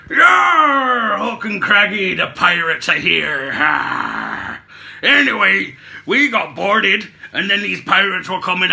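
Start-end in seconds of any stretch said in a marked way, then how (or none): 0.1-4.6 loud opening "Yar" falling in pitch to a long "Ha"; pirate voice. Acted. Acting out the flashback of boarding, hammed-up pirate roar. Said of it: "give you a, a flashback".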